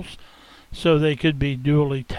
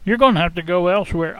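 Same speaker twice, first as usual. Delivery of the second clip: loud, flustered refusal, high pitched; blurting out; 4 semitones higher, 6 dB louder than usual. Acted. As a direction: blurt it out loudly, annoyed. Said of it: "I blurted out".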